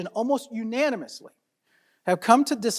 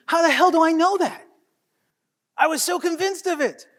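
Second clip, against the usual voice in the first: high-pitched exasperated disbelief; incredulous self-mockery; 5 semitones higher than usual. Lawyer owning up to wrongly assuming fraud, incredulous self-mockery. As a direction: scold yourself, voice pitched up. Mocking own snap judgement.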